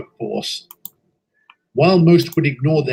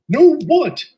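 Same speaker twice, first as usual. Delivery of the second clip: much higher, louder, urgent; alarmed question; 11 semitones higher, 5 dB louder than usual. Acted. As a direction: demand to know at once. Engine shocked by news.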